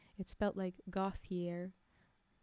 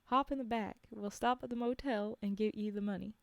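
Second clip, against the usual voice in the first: put-on Alabama accent. Hunter at car window offering money, Alabama accent. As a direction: give the man at the window his Alabama accent. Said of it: "every time I'm forgetting to give him the accent".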